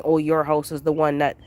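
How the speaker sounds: ordinary talk, nothing marked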